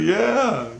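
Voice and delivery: high-pitched mock "yeah"; exasperated confirmation. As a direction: imitate the exasperated buddy in a high voice. Friend's exasperated yes, repeated.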